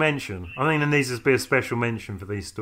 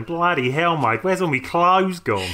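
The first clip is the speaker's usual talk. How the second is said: put-on rough stab at an Australian accent, drawn out and slightly raised; bad Australian accent; 4 semitones higher, slower than usual. Man waking up naked in heaven, bad Australian accent. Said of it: "I do apologise for that bad accent".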